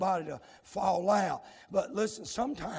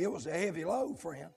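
ordinary talk, nothing marked

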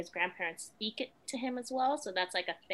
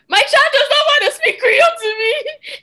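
high, loud, tearful wail; retold sob, voice breaking high; crying; 20 dB louder than usual. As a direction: cry it out, heartbroken. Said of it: "I sat there and I cried".

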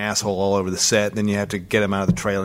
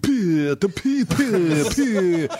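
put-on much higher, kid's silly chant; childish squeal; 7 semitones higher, much slower than usual. Boy on the plane teases the stranger, high childish voice. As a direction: play the cheeky little boy, lightly voiced.

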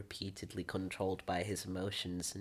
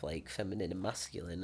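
ordinary talk

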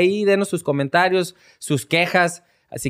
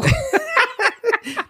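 burst of laughter. Real, not acted. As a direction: crack up laughing. Play fight with brother-in-law.

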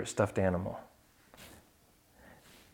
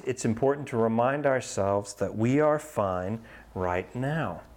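plain reading voice

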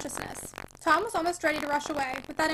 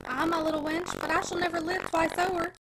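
ordinary delivery, nothing marked

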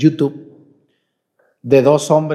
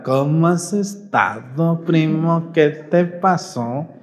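slow, easygoing Yucatán drawl; slow it way down like a yucateco; calm Mérida speech; much slower than usual. Retold or imitated. Preacher imitating relaxed men from Mérida, slow Yucatecan drawl. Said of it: "Calmadito hablan".

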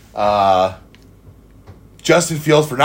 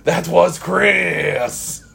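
very drawn-out, hammed-up French accent; mock French accent; 3 semitones higher, much slower than usual. Host naming the co-host in the sign-off, mock French accent. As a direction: stretch the name like a cartoon Frenchman. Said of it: "doing French accents for everybody's voice".

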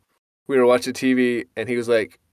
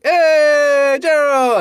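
loud, high, drawn-out greeting yell; re-enacted whooping shout; exuberant holler; 6 dB louder, much slower than usual. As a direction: holler a friend's name across the showers. Acts calling out to friend.

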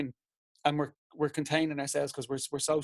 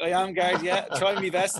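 light laughing, a bit louder; laughing reply; 4 semitones higher, 4 dB louder than usual. Retold or imitated. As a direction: answer the guard through laughter. Retelling a friendly chat with a guard.

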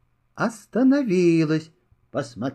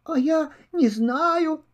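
small kitten voice; 9 semitones higher than usual. Acted. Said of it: "отвечает".

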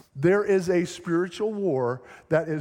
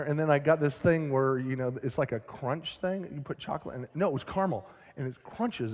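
usual speaking voice

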